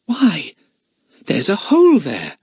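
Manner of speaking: high, startled discovery; a sudden little gasp of finding; surprised. A narrator's reading. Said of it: "said Lucy Ann in surprise".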